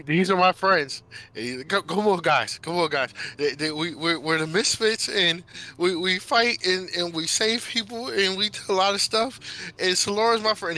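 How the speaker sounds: high, stammering, gushing introductions; excited kid. Acted. Midas introducing friends to his parents, excited.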